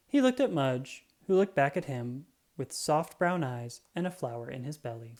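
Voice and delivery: plain reading voice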